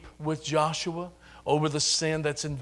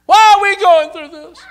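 loud high anguished why; imitating despairing cries; mock crying out; 18 dB louder, slower than usual. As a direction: wail it at heaven. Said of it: "crying out".

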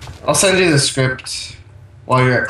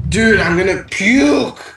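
slow, high, drawn-out sick moan; nauseous groan; 7 semitones higher, much slower than usual. Real, not acted. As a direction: stretch it out like you might throw up.

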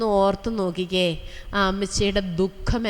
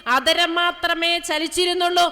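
fervent preaching; 9 semitones higher than usual. Acted. Sister preaching to the congregation about tearful prayer, fervent and high.

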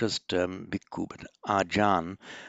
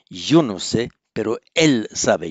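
yo and él pushed out louder; contrastive emphasis; 8 dB louder than usual. Shown as a demonstration. Teacher showing Spanish pronoun emphasis to the class. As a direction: stress "yo" and "él" to set them against each other. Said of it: "emphasize the difference".

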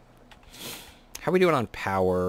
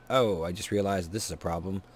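normal talking voice

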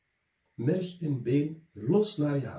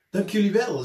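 higher, louder captain's thanks; grateful little captain; 9 semitones higher, 7 dB louder than usual. A narrator's reading. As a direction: thank the helpers brightly. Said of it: "zei de kleine kapitein". (de kleine kapitein bedankt de kinderen, hoger en luider)

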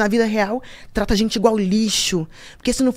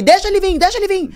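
loud dare; 7 semitones higher than usual. Retold or imitated. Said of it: "eu falei alto pra ele ouvir".

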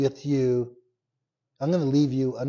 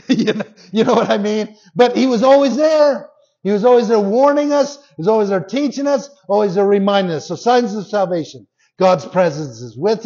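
loud, high, fast preacher's build; preaching at full force; 7 semitones higher, 8 dB louder, faster than usual. Real, not acted. Pastor rousing his church, loud and fast. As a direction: hammer it home to the congregation.

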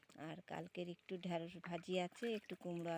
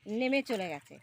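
calling out; 4 semitones higher, 8 dB louder than usual. Real, not acted. Calling out, directing the ducks.

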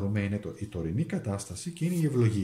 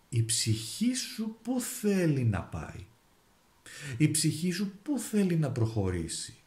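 slow, higher, searching question; soul's question; 5 semitones higher, slower than usual. Acted. Tarot reader voicing the card's question to the viewer, slow and searching. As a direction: ask it slowly from above, like the soul calling.

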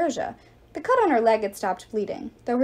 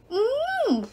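high, muffled complaining whine; sock-gagged goddess whining; gagged whimper; 13 semitones higher than usual. Acted. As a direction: protest through a gag. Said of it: "Nike complained".

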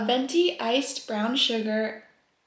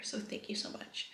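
whispered thanks; 14 dB quieter than usual. Real, not acted. Soft thanks to her friends.

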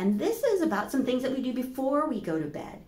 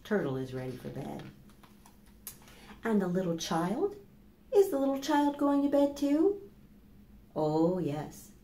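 put-on reading to children; slower than usual. Teacher reading a bedtime book to children, gently.

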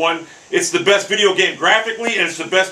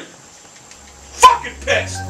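hissed anger; much slower than usual. Real, not acted. Angry after bogey.

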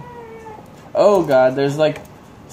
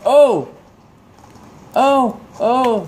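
sudden high yelps of shock; startled cries; 9 semitones higher, 4 dB louder, much slower than usual. Real, not acted. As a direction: cry out, caught off guard. Hit by something in game.

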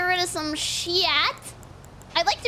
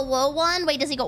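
ordinary delivery

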